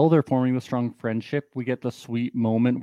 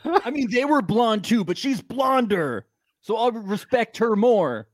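frat bro; 9 semitones higher than usual. Retold or imitated. Mocking Leo as bro.

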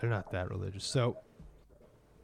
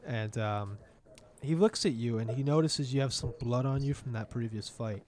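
usual speaking voice

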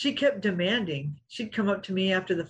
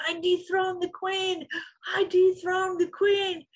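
much higher, shrill-free gloating shout imitating the rival; imitating the opponent's victory cry, pitched way up; mock triumphant yell; 12 semitones higher than usual. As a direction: crow it like a winner jumping up and down. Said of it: "jumping up and down and screaming and yelling".

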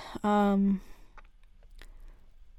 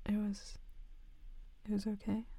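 shy whisper; 9 dB quieter than usual. Acted. Shy after the kiss.

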